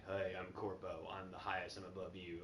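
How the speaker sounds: smug corporate voice. Retold or imitated. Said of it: "I'd be like".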